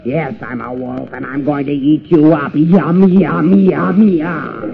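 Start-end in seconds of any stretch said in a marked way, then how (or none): 2.5-4.7 gloating yum. Acted. Wolf gloats over meal.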